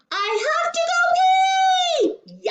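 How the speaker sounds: put-on very high, long drawn-out child's yell; urgent yell. A narrator's reading. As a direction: wail it out like a desperate little boy. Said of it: "when Andrew yelled".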